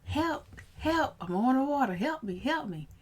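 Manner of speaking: high, loud retold screams for help; replaying the panic of drowning, loud and high; panicked cry for help. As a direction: yell for help like you're going under. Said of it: "I was saying".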